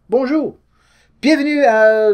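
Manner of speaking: bright, high French-style greeting; playful mock French TV welcome; mock French intro. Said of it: "I don't know if I could do a whole video in French".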